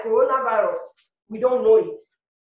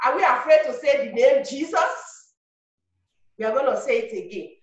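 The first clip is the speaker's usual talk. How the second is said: ordinary talk, nothing marked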